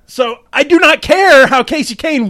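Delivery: excited. Real, not acted. Repeating dad's creed, now excited.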